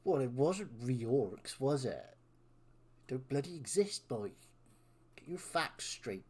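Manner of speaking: taunting West Country villain. Acted. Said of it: "doing a villain voice in West Country".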